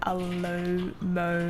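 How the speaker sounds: Alomomola, slow, each syllable spaced; sounding out words. Real, not acted. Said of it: "I have to sound out each syllable".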